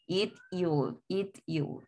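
"It, you" as two quiet separate beats; dos sílabas. Shown as a demonstration. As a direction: break "It" off before "you". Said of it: "Se escuchan dos". ("It, you" en dos sílabas separadas)

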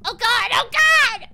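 loud, high panicked cry. Real, not acted. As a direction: panic as the ghost hunts. Ghost scares player.

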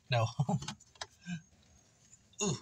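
ordinary delivery

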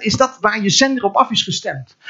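ordinary delivery

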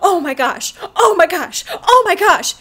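real shock building to a high, loud peak; mounting shock. Real, not acted. Shocked by sudden plot realization.

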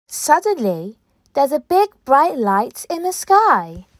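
ordinary delivery

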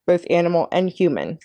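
ordinary delivery

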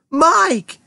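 high, urgent call; cried. A narrator's reading. Mother seeing her son on screen, crying out. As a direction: cry the name out high. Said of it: "cried Missus T V".

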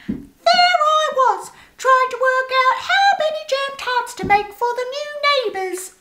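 very high, squeaky mouse chatter; a chatty mouse telling a tale. A narrator's reading. Moby Mouse voiced high.

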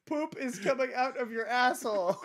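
high, loud, laughing mock yell; mocking the mom. Host mocking the clip's yelling mom, laughing mock yell. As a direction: ham up the angry mom, laughing.

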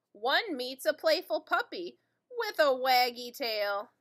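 ordinary delivery

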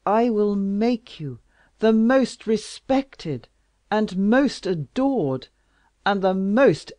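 loud, slow, ardent royal promise; passionate king. Acted. King promising Louise glory, loud and slow. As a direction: declare it grandly.

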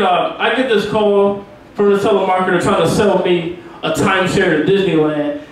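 usual speaking voice

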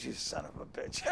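low-volume, voiceless retold swear; muttered curse. Friend turns round after being exposed, cursing quietly. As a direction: mutter it under your breath at a friend.

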